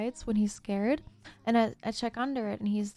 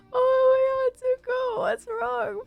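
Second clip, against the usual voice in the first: much higher, pleading, retelling the panic over the cat; high tearful begging, as if back in the moment; panicked crying plea; 13 semitones higher, 3 dB louder than usual. Retold or imitated. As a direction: cry out to the sick cat in a high, frantic voice. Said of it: "I started bawling my eyes out".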